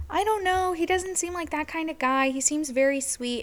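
high, mom voice, retold.

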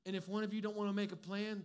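ordinary talk, nothing marked

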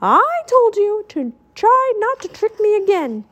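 high, cross scolding shout. A narrator's reading. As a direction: scold from inside a tent. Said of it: "Mickey shouted from inside the tent".

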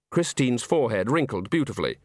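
ordinary delivery, nothing marked

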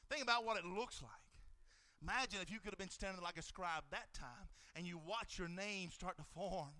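soft, low invitation; hushed. Real, not acted. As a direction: draw them in softly to imagine. Hushed imagining after shouting.

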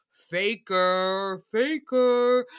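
exaggerated coworker heckle; mock accusing. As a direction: call them out in a stretched taunt. Mimics coworkers' instant accusation.

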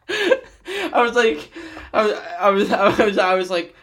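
high, slow words through laughter; laughing. Real, not acted. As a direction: talk through the giggles. Laughing while retelling.

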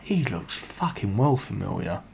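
whispered, dawning recognition; hushed aside. Retold or imitated. Narrator to himself about the stranger in the cab, whispered. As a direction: whisper it privately to yourself, very soft. Private thought about a familiar face.

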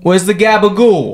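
a mock mobster asking for food; New York accent. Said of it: "Angry New York is easy".

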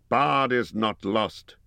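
slow, ringing declaration; a measured cry; cried out. A narrator's reading. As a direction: call it out slowly to the crowd. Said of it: "he cried".

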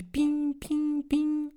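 high, bright ping ping imitations; ping like a phone buzzing with alerts; notification pings. Artist's phone pinging, imitated high notification sounds.

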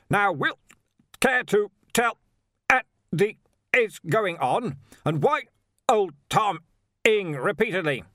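high, loud shout broken by gaps; irate Arch-Chancellor, shouting. A narrator's reading. Arch-Chancellor demands an explanation over the bell's silences, shouting. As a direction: bellow in bursts, words dropping out.

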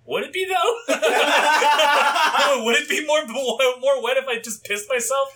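high, laughing, disbelieving question; laughing self-doubt. Retold or imitated. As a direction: crack up while wondering.

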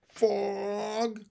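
high, long-held plaintive word; forlorn, stretched-out repeat; sad stranger. Acted. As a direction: draw it out mournfully on one high note.

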